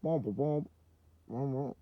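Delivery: a muffled, honking cartoon teacher; a lightly voiced, muffled cartoon teacher; better Charlie Brown adult. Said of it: "That's probably better".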